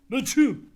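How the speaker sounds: big, drawn-out mock sneeze; hammed-up sneeze; loud fake sneeze. Sneezing again in character.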